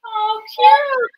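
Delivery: high squeal of delight; delighted surprise. Real, not acted. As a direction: gush over something cute. Shown baby pumpkin.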